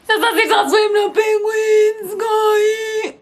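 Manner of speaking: very high, loud, slow wailing complaint; anguished wail. Retold or imitated. Mourning the penguin swim she lost, high loud wail. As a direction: wail it out in mock despair.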